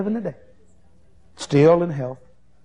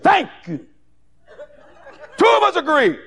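triumphant woman; 13 semitones higher, 4 dB louder than usual. Retold or imitated. Woman triumphant after prayer.

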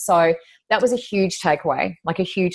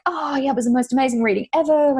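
higher, lightly gushing imitation; a touch of gushing enthusiasm; came alive; 7 semitones higher than usual. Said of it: "just came alive and she was like".

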